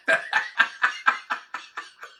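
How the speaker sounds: breathy burst of laughter; real laugh. Real, not acted. Storyteller amused by the handkerchief scene, real laughter. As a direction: crack up in a run of chuckles. Teller laughs at own retelling.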